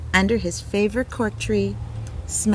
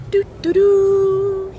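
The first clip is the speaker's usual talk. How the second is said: high, slow, louder sung toots; imitated bugle; 11 semitones higher, 5 dB louder, much slower than usual. A bugler in the parade, slow high toots. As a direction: play a fanfare with your voice. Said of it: "He's got a bugle".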